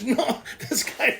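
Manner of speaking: usual speaking voice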